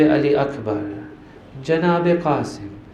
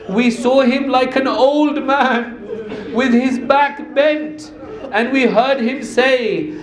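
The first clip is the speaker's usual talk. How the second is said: higher-pitched lament, the voice catching with sobs; tearful lament; 7 semitones higher than usual. Acted. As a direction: mourn aloud as the story turns. Lament rising into tearful recitation.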